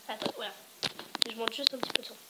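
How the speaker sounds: ordinary delivery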